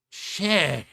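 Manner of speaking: sudden loud alarmed outburst; startled curse. Real, not acted. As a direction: blurt it in shock. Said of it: "Excuse me, I said".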